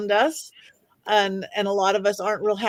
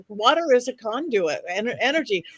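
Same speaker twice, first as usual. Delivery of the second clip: ordinary talk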